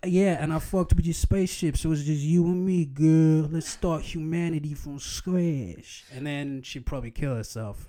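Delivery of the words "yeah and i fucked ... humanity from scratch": loud smug flirting voice; hammed-up smooth talker; cheesy pickup line. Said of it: "I'll be like".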